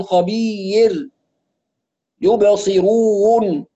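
خبير as khabi-yir, يبصرون as yubsiru-wun; stretched glides. Retold or imitated. Teacher mimics wrong reading, stretched glides. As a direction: add a glide before the last consonant of خبير. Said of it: "يمط في الواوات وفي الياءات".

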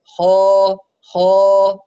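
خا heavy but without hard pressing; teacher modelling the right خا; gentle correct kha. Shown as a demonstration. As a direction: let خا out easily, no forcing. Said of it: "انتبه إلى عدم الضغط بقوة".

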